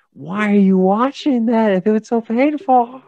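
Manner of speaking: high, loud, drawn-out protest; stretched-out, high-pitched mock complaint; mock exasperation. Mock outrage at a negative comment.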